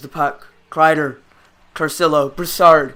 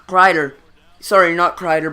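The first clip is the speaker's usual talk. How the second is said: ordinary delivery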